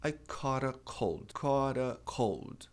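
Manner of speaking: slow demo, caught a cold linked with a tapped T; slow flap demo. Shown as a demonstration. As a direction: glide "caught a cold" slowly, the T tapped like a quick D. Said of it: "juntando o A".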